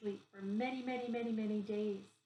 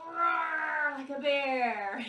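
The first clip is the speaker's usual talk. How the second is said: slow, loud, higher stretched roar; drawn-out bear roar; 4 semitones higher, 7 dB louder, much slower than usual. Shown as a demonstration. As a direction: drag out the roar like a big bear. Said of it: "come back to the camera and go".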